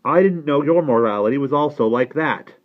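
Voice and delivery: pointed, disapproving retort; challenging nephew. Acted. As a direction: throw the accusation back at your uncle. Nephew retorts to his uncle.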